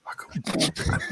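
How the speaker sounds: soft unvoiced whisper. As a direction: breathe it quietly. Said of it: "Why are we whispering?".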